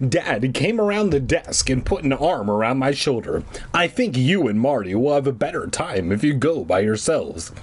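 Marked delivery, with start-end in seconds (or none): none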